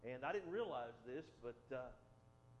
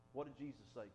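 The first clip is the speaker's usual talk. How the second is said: ordinary delivery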